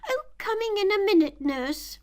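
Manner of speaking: high, loud, quick reply; calling back. Acted. Alice calling back to the nurse, high and loud. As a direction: call back hurriedly to the nurse.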